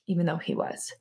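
normal talking voice

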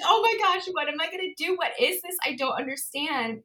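high, frantic retelling of her panic; panicked self-quote. Retold or imitated. Remembering the check from Tristan, high panicky retelling. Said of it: "And I'm like".

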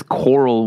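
ordinary delivery

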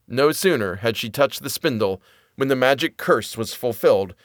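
ordinary delivery

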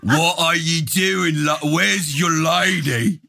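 put-on British gangster accent. Mocking how they'll greet the English guest, in a hammy British accent. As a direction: play a Peaky Blinders thug in a British accent. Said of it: "now you sound like Peaky Blinders".

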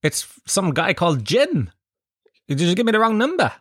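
high, quick mock Australian chugger; chugger pestering. Australian chugger catching the comic outside his flat, high cheery voice. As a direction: chase him down brightly, high and cheery. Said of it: "He would have gone".

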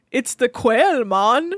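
mock Jamaican patois; lightly imitated Jamaican accent. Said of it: "doing Jamaican patois".

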